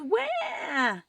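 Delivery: high, loud, drawn-out wail; baby yell. A narrator's reading. Baby ghost woken by all the noise, loud wail. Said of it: "waked up with all this noise and yelled".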